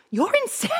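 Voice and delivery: high laughing disbelief. Retold or imitated. Retelling her reply to him, laughing high. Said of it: "I was like".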